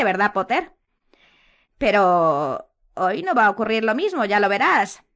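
high, loud gloating; Umbridge voice. A narrator's reading. Umbridge taunting Potter before the curse.

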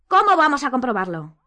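loud, high falling question; impatient demand. A narrator's reading. Harry impatiently demands an answer.

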